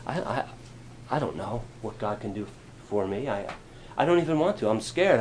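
fearful believer speaking quietly to himself; scared and unsure. Retold or imitated. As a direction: shrink small and hesitant. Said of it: "that's sometimes how we feel".

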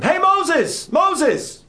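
high, loud call to Moses; God calling out, high-pitched yell. Acted. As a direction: shout out to someone passing by. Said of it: "God starts yelling to him".

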